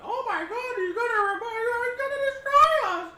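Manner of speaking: shrill, high, loud panicked squeal; panicked kitchen appliance. Pretend. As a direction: cry out like terrified cookware. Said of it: "all the kitchen heard me saying that".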